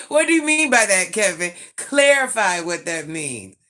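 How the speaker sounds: high, loud laughing challenge; laughing, pretending to demand Kevin explain himself; laughing mock demand. Real, not acted. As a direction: demand answers while laughing.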